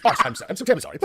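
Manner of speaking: mock-British over-polite apology; British accent. Comic correcting the word to arse, British accent. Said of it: "You say with a British accent".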